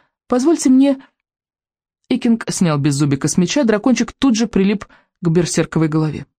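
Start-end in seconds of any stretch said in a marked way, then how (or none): 0.3-1.0 high-pitched courteous request; polite. A narrator's reading. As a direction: ask timidly for permission, pitched up. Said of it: "вежливо произнёс он".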